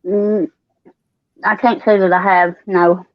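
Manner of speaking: normal talking voice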